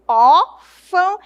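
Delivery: ÓRFÃO slow and loud, open ó then nasal ão; open O, nasal ending. Shown as a demonstration. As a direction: stretch "órfão" into two parts, punching the open ÓR. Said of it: "um som aberto".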